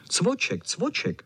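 high, yappy calls; barking dogs. A narrator's reading. All the dogs call for Cvoček, high barking. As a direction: bark the name out twice, high. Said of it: "štěkali všichni".

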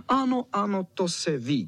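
high, eager mock shout; dogs crying out. A narrator's reading. All dogs backing the terrier, high mild shout. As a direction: chime in high like a pack agreeing. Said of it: "křičeli všichni psi".

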